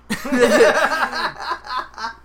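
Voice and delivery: high burst of loud laughter. Real, not acted. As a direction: crack up hard at the punchline. Speaker cracks up at own story.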